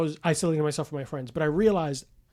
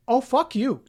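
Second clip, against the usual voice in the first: higher, defiant jab; mock defiance; 8 semitones higher, 3 dB louder, slower than usual. Answering the doubting doctor with mock defiance. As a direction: toss it back defiantly.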